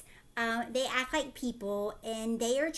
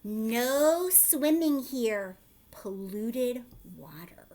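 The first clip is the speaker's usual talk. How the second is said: slow sign reading; 3 dB louder, much slower than usual. Acted. Reading warning sign slowly.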